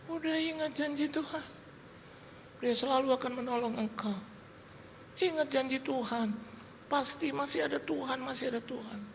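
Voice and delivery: high, soft motherly voice; reassuring mother. Retold or imitated. Mother comforts her crying son with God's promise, soft reassurance. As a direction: soothe gently in a high, light voice. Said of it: "ibu saya bilang".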